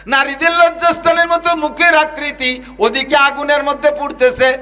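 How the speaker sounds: high ringing chant; preaching at full force. Acted. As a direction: cry it out in the sermon tune. Waz preacher chanting vision. (উঁচু সুরে ওয়াজ)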